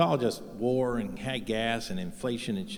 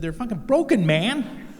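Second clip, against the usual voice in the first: incredulous outburst; 10 semitones higher, 4 dB louder than usual. Retold or imitated. Arguing with Goodwill shopper.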